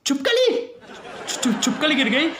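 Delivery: high-pitched, stammering alarm; frightened shock. Acted. Husband hearing of the lizard from wife, panicked stammer. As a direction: squeal it, tripping over the first syllable.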